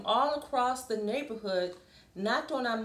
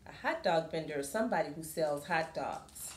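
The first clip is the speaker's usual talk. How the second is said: plain reading voice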